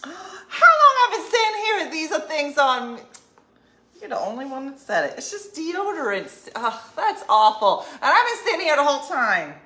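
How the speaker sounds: high, loud, gasping dismay; gasp, then complain loudly and high; mortified outburst. Real, not acted. Finding deodorant on the shirt mid-show, real high loud mortification.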